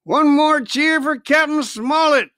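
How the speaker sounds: high, loud, slow rallying cry; cried out. Acted. Long John calling on the crew for another cheer, loud and high. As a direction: rouse the crew to cheer again. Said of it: "cried Long John".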